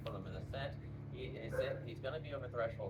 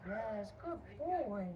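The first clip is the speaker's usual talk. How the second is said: dog praise voice; 9 semitones higher, much slower than usual. Real, not acted.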